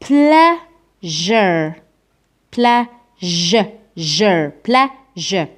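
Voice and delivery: بلاجر broken into slow syllables, "plea-zhure", with a long buzzing zh; pronunciation demo. Shown as a demonstration. Teacher shows students two letters' sound, slow syllable-by-syllable demo. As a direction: split بلاجر and stretch the zh. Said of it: "ركز معايا كيفاش راح ننطق هذو الزوج حروف".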